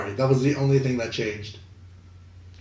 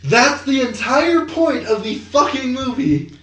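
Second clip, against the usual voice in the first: high, slow, exasperated outburst; exasperated retort; 10 semitones higher, slower than usual. Retold or imitated. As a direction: spit it out, fed up and incredulous. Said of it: "I'm like".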